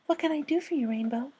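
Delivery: Fluttershy voice. Acted. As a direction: speak like a shy gentle mare.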